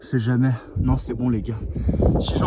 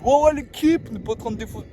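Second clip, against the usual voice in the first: mocking YouTuber voice; 8 semitones higher than usual. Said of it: "en mode".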